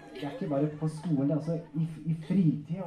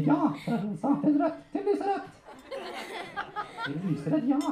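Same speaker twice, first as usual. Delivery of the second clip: high, cheeky ventriloquist puppet voice; puppet character; 13 semitones higher, 3 dB louder than usual. Acted. Puppet correcting its handler, high comic voice. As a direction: pipe up higher, like a comic puppet. Puppet tells about its teacher.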